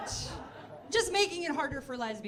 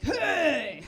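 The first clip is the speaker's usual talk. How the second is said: loud, dopey dude voice; dude impression; 4 semitones higher, 4 dB louder, much slower than usual. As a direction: holler like a caricature of a guy. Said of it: "And then I have dude".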